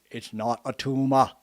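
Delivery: put-on lightly done Austrian accent, flat and firm; a hint of Arnold's Austrian deadpan; mild Austrian-accented denial; Arnold Schwarzenegger impression. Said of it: "Arnold Schwarzenegger, like I said".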